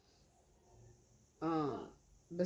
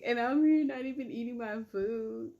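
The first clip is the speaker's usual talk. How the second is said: high laughing, louder; 5 semitones higher, 4 dB louder than usual. Real, not acted. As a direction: giggle through it, pitched up. Laughs at herself, mukbang.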